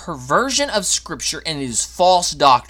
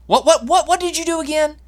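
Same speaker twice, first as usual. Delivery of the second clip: mock forgetful God; 12 semitones higher than usual. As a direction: play a confused old man who forgot. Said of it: "spiritual amnesia where he says".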